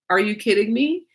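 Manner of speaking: ordinary talk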